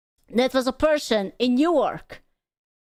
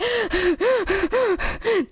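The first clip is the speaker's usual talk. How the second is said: startled gasp; 9 semitones higher than usual. Retold or imitated. Startled by the joke.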